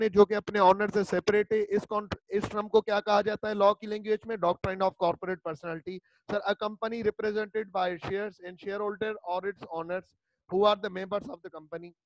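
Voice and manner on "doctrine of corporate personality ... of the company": धीमे-धीमे शब्द तोड़कर पढ़ना; slow dictation. Shown as a demonstration. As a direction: read it out slowly, word by word, for notes. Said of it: "just read करनी है मुझे". (Handout से धीमी श्रुतलेख जैसी पढ़ाई)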